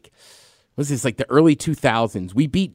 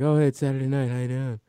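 mock sad guy; 2 semitones lower, 7 dB quieter than usual. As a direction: play a lonely guy making a sad little call. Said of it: "like, sad guy".

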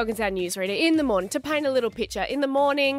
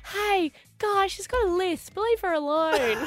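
higher, kindly teacher scolding the class; protective teacher; 8 semitones higher than usual. Retold or imitated. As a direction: shield the pupil like a kindly teacher. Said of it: "And she just thought".

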